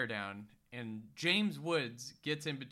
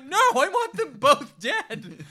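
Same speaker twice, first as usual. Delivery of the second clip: mock shout; 10 semitones higher, 10 dB louder than usual. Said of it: "It's like".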